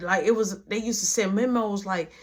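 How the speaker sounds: ordinary delivery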